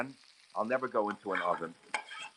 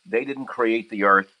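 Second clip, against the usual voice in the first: angry; 8 dB louder, slower than usual. Real, not acted. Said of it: "I'm so angry at them".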